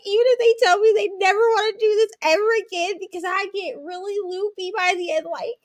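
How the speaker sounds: high-pitched, blubbering retelling of tears; hammed-up tearful wreck, pitched high; mock weepy babble. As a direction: ramble through put-on sobs. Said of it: "I was a wreck".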